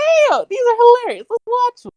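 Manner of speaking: high-pitched, hyped-up mock enthusiasm; retold excitement. Friend retelling finding funny videos, mock excited. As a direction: squeal it lightly, as if thrilled by a find.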